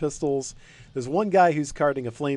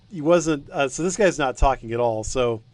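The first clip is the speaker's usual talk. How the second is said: usual speaking voice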